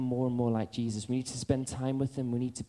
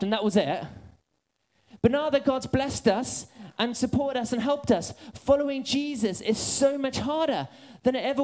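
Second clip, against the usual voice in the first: fired-up sermon voice, much higher and louder; full-force preaching; 10 semitones higher, 6 dB louder than usual. Acted. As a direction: preach it high and loud, pushing hard.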